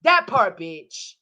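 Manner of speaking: normal talking voice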